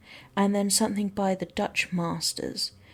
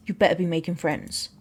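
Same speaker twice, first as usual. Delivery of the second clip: dropped pitch, mock-stern parental warning; mock-stern order; 5 semitones lower than usual. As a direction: drop low and order it like a playful dad. Dad turns question into mock order.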